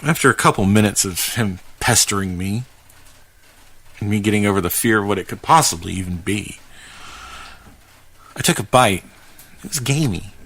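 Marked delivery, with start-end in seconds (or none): none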